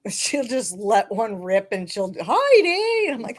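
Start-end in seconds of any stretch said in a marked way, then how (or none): 2.1-3.1 mock yell. Imitating Marissa yelling name.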